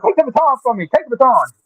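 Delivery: pleading way up high; squeaky high plea to be rescued; high-pitched plea. Real, not acted. Begging others to take over.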